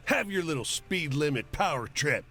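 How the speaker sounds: high-pitched, scornful bark; angry bark. A narrator's reading. Furious driver to the cop who stopped him, barking. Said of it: "the driver barks".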